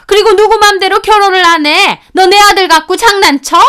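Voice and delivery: put-on shrill furious older woman yelling; angry mother-in-law. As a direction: scold like an outraged mother-in-law. (화난 시어머니의 고함)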